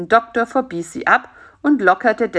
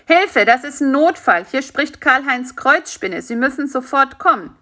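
higher, louder emergency call; urgent plea; 6 semitones higher, 4 dB louder than usual. A narrator's reading. Spider phones the doctor for help, urgent plea. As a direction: plead urgently for immediate help.

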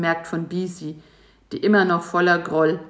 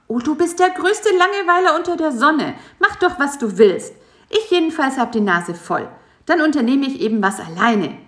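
high, loud furious insect; angry outburst; 8 semitones higher, 5 dB louder than usual. A narrator's reading. Bisi furious at the lazy spider, high and loud. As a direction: snap at him, fuming and high. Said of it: "rief Bisi erbost".

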